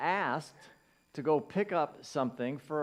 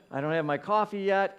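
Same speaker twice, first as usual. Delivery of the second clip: ordinary talk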